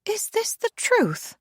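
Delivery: high, slow, imperious demand. A narrator's reading. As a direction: demand the truth from him. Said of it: "turns to Alistair and demands".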